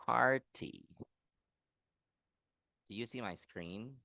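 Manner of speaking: usual speaking voice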